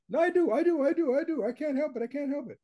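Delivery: high-pitched mock protest, rattled off as one run; put-on high voice, pleading helplessness; voice up an octave. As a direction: imitate a brain protesting in a squeaky-high voice. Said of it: "Your voice goes up an octave".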